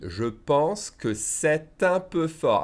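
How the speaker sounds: emphatic slow reading. Shown as a demonstration. Teacher emphasizes how we read it, slowly. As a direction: draw out each word as written. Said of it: "I'll emphasize how we read it".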